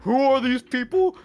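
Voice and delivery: high, loud imitation of outraged commenters; mock indignation. Mimicking Reddit users rejecting their posts, high mock indignation. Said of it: "they're like".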